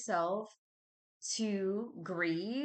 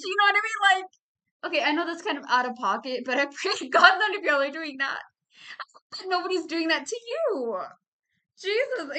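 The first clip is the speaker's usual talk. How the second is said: usual speaking voice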